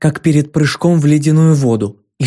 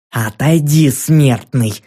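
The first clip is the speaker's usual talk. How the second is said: plain reading voice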